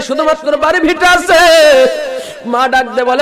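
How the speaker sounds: high, loud, chanted appeal; full-force preaching. Acted. Preacher pleading with the young men, high and loud. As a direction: cry out to the young men in a sung pitch. Mother's plea chanted in sermon.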